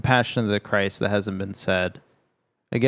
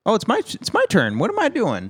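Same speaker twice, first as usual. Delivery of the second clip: much higher, flustered surprise; sudden realization; 11 semitones higher than usual. Real, not acted. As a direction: caught off guard, it's your turn. Realizes it's his turn.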